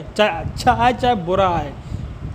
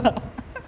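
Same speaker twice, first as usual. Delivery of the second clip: breathy chuckle; 7 dB quieter than usual. Real, not acted. Laughing at own story.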